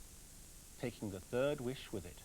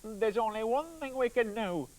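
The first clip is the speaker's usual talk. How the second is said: panicky woodcutter; 7 semitones higher, 6 dB louder, slower than usual. Acted. Panicked man, sausage stuck.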